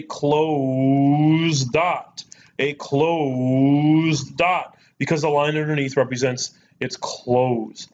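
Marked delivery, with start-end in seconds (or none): 0.0-4.8 very slow, stretched words; teacher stressing the key term slowly; slow emphatic. Shown as a demonstration. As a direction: draw out closed dot for the class.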